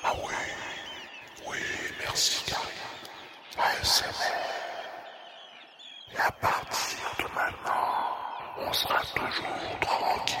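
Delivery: hushed airy whisper; ASMR whisper. Acted. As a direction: whisper close to the mic.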